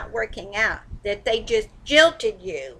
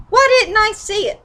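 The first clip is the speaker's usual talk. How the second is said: exasperated outburst; 10 semitones higher, 4 dB louder than usual. Real, not acted. Exasperated self-question, loud.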